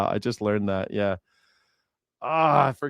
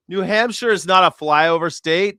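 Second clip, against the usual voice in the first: louder, higher insistence on "New Hampshire is not a flyover state"; emphatic correction; 6 semitones higher, 6 dB louder than usual. Acted. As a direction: push back hard on "not", louder and higher.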